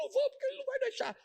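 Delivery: imitating a whiny sobbing girl, very high and fast; mock the tearful cousin, squeaky and rushed; crying girl. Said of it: "Minha prima chorando". (imitando a prima chorosa, bem aguda e rápida)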